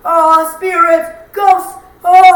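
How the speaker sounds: slow, high, loud mock-spooky wail; mock spooky movie. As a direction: ham up a spooky ghost movie. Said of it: "those kinds of movies that are like".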